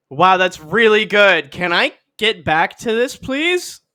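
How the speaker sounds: drawn-out, high, fake-impressed kid; loud, slow praise rising into a high "please"; mock politeness. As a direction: humour Dad with exaggerated, sing-song enthusiasm. Voices unimpressed kid humouring dad.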